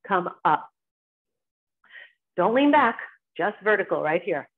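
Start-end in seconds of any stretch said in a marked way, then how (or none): none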